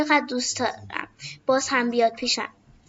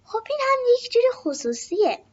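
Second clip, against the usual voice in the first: higher, gentle softening voice; more kindly; 9 semitones higher than usual. A narrator's reading. Girl softening toward the little boy, gentle and kind. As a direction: make up for being sharp, kindly.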